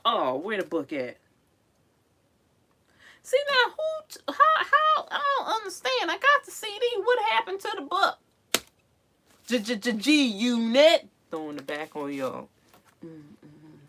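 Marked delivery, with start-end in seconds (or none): 3.2-8.2 much higher, baffled voice; high-pitched befuddled searching; a high, bewildered squeak; 1 squeaky confusion. Real, not acted. 9.5-11.1 1 hype announcer. Pretend. Announcing rap album hype.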